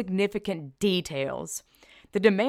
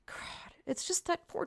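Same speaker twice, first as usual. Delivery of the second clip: breathy whisper of despair; 9 semitones higher, 11 dB quieter than usual. Real, not acted. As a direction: hush it, sadly.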